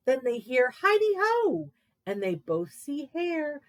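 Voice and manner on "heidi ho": high, louder sing-song call; Hare's greeting. Acted. Hare calling at the lair door, high cheery call.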